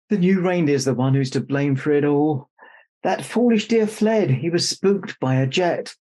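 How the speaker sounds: put-on lively read-aloud for children; story narration. A narrator's reading. Author reads her picture book to children, lively storytelling. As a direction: read the rhyme brightly to the kids.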